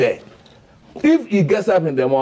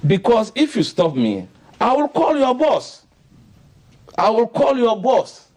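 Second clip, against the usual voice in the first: high-pitched mock threat; lightly acted self-important bishop; Salifu Amuako; 7 semitones higher than usual. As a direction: imitate a big man pulling rank, pitched high. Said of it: "Look at his talk".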